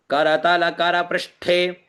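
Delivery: करतल कर पृष्ठे slowly, syllable by syllable; slow demonstration keeping final vowels in करतल कर; every vowel sounded. Shown as a demonstration. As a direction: draw out ka-ra-ta-la ka-ra with each vowel full. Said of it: "जैसे लिखा हो उसे वैसा ही बोले".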